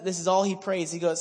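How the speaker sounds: ordinary delivery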